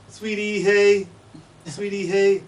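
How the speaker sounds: "Sweetie, hey" whistled high on one constant pitch; Martha's Vineyard chickadee. Shown as a demonstration. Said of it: "they say".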